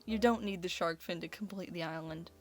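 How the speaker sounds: ordinary delivery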